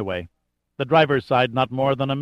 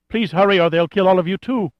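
terrified plea; 6 semitones higher, 3 dB louder than usual. A narrator's reading.

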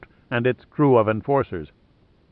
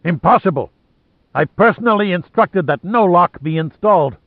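loud, higher furious outburst; 6 semitones higher, 4 dB louder than usual. Acted. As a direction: shout it in outrage. Lohan furious at guards.

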